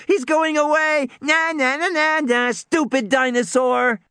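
put-on girl jeering at the retreating dinosaur; taunting squeal. A narrator's reading. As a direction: tease the dinosaur like a kid. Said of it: "Lex squealed".

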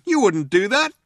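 high, loud protest; Trillian crying out. A narrator's reading. Trillian challenging the threat to blow up the planet, crying out. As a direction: cry it out in disbelief. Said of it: "cried Trillian".

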